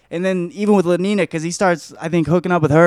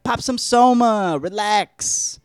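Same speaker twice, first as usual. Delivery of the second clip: higher, drawn-out mock chill-out voice; laid-back urging; 6 semitones higher, slower than usual. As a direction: stretch it out like a mellow party pusher. Voices man urging John to relax.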